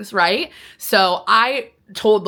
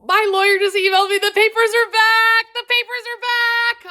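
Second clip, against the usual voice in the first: much higher and louder, thrilled; excited; 14 semitones higher, 4 dB louder than usual. Real, not acted. Telling viewers the papers are back, thrilled. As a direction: squeal the good news. Good news just arrived.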